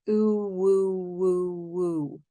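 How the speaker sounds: "woo" with lips rounding, opening and closing again; slow "Ooh, woo, woo" with lips closing and reopening; over enunciating. Shown as a demonstration. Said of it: "Watch my lips".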